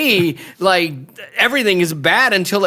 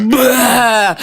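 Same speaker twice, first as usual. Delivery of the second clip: mock frustrated cry; 9 semitones higher, 4 dB louder than usual. Mock outburst in retold reaction.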